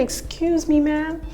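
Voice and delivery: high-pitched, mock-polite indignation; indignant comeback. Acted comeback to wedding critics.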